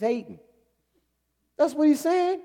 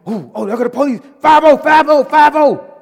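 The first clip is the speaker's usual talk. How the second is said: street lookout warning; 6 semitones higher, 12 dB louder than usual. Retold or imitated. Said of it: "And they be like".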